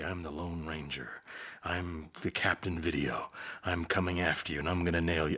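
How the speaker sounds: cool, low, understated tough-guy delivery; Clint Eastwood. Acted. Streetwise guy to a bad guy he stalks, cool low delivery. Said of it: "I was doing Clint".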